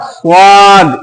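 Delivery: "स्वाद" with a heavy, rounded, long aa; thick स्वाद. Shown as a demonstration. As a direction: round the mouth and draw out "स्वाद". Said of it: "थोड़ा सा हर्फ को मोटा कर देंगे". (मोटा, खींचा हुआ "स्वाद")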